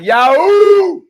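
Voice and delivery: high, loud whoop of joy; shouted cheer, full of energy; excited yahoo. Shown as a demonstration. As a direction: let out a big joyful yell. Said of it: "Y'all supposed to be like".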